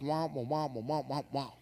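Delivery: muffled, wordless grown-up gibberish; quiet cartoon trombone-like mumbling; Charlie Brown's teacher. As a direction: imitate meaningless wah-wah babble, softly. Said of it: "kinda like Charlie Brown and his teacher".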